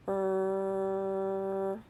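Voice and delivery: "Er" as one long held r sound; slow sound. Shown as a demonstration. Teacher models a sound for children, "Er" held long. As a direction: hold "Er" steadily on a level tone. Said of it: "say a sound slowly".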